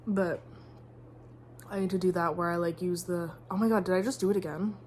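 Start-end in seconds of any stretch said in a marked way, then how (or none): none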